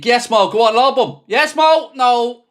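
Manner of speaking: high, loud urging of a striker through; excited shouting. Real, not acted. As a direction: yell him on, rising with each call. Fan urging Salah during attack.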